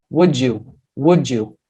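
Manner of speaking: "Would you" said as one word, "wou-dju"; linked J sound. Shown as a demonstration. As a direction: join "Would you" with a J for D plus Y. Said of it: "vira J". ("Would you" emendado, com o D virando J)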